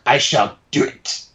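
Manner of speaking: slow, sinister Emperor voice; Palpatine impression. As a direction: say it slowly, as the Emperor granting a request. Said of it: "gave me a small impression of Palpatine".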